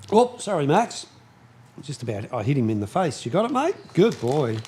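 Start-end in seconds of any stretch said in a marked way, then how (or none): 0.1-1.1 high, startled sorry to the dog; sudden apology. Real, not acted. Accidentally hit dog in face. 3.2-4.6 high, sing-song praise to a dog; dog talk. Real, not acted. Talking to dog with treat.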